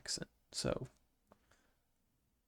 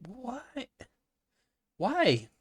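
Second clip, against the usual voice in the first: real high-pitched, incredulous why; baffled disbelief; 10 semitones higher than usual. Real, not acted. Reacting to basketball player's friendship, baffled and high.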